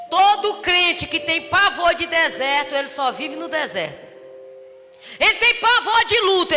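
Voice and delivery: loud, high, fervent preaching; rising revival sermon; preaching at full force. Acted. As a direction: preach it out to the whole church.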